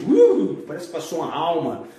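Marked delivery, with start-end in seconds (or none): 0.1-0.6 high, loud "uh" of a sudden chill; acted chill running through you; mock shiver. Shown as a demonstration. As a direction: shudder out a put-on calafrio. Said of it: "Sabe aquele calafrio?".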